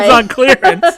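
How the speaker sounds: high, loud salesman voice; mock sales pitch. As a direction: hype it like a bargain ad. Repeats the joke line.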